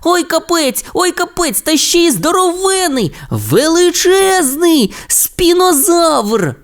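дуже високо, останні слова протяжно; ахай від захвату й страху; вражений вигук. Acted. Тімі бачить чудовисько. (Тімі бачить спінозавра: приголомшено)

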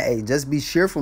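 normal talking voice

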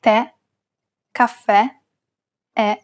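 "Tè, caffè, è" with a stressed open E, as in "bet". Shown as a demonstration. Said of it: "pronounce it as an open E".

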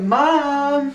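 high, drawn-out call to mom; modelling little sister's line. Pretend. Brother coaches little sister on greeting mom, high mock call. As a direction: pitch it up, pretending to be the kid. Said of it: "you're gonna be like".

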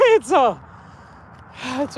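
high laugh breaking into the words. Real, not acted. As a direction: chuckle at your own mistake. Laughs at mistaking a log.

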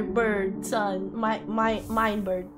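ordinary delivery

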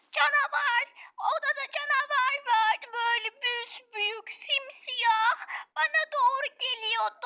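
put-on high, breathless little girl describing a monster; high child voice, shaken; frightened child. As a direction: tell it wide-eyed and scared.

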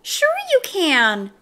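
high, slow, coaxing creature; drawn-out, high-pitched temptation; pigtail's little voice. A narrator's reading. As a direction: egg him on in a little voice. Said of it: "said the pigtail".

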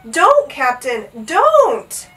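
loud high cry, drawn out; desperate plea. A narrator's reading. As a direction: cry out to stop someone. Begging captain to stop.

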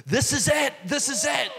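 high, thrilled cry of recognition; excited exclamation. Retold or imitated. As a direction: blurt it out like a man who found the answer. Excited director with blueprint.

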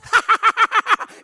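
loud, very high mock cackle; class clown's laugh. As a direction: imitate a kid's shrieking cackle. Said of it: "he begins laughing".

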